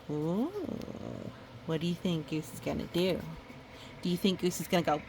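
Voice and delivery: usual speaking voice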